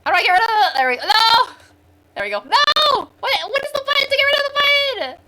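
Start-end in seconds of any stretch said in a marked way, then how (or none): 1.0-5.2 high, loud panicked cries; frantic. Real, not acted. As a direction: squeal at the stubborn button. Fighting with the software.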